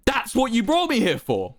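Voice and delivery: high-pitched, barely held-in anger; indignant, fighting to contain rage. A narrator's reading. Wilbur replying to an insulting offer, containing his rage. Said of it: "replied shakily, trying to contain his rage".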